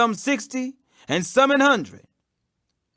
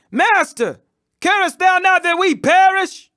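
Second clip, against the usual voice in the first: higher and louder, pleading in panic; disciples' alarmed plea to the sleeping master; urgent frightened plea; 7 semitones higher, 4 dB louder than usual. Acted. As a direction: cry out to wake him as the boat sinks. Frightened disciples wake Jesus in storm.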